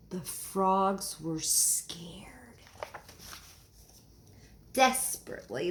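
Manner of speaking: slow, soft whisper; spooky story whisper. A narrator's reading. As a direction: hush it for suspense. Storyteller building suspense.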